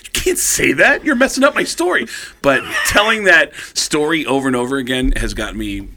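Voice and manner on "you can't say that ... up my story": high, indignant correction; acted-out exasperated protest; indignant retort. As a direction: set him straight, voice jumping up. Indignantly correcting Lars in the story.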